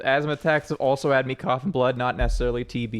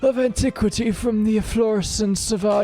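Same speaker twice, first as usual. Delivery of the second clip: antique dealer voice; 7 semitones higher than usual. Acted. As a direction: pitch it up like a fussy dealer. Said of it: "I love that voice".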